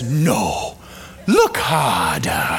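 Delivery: put-on an old mentor urging a closer look; deep wise voice. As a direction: draw it out like an ancient sage. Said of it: "And Rafiki says".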